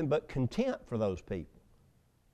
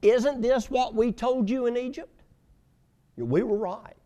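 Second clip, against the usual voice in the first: told-you-so; 10 semitones higher, 3 dB louder than usual. Acted. Israelites accuse Moses.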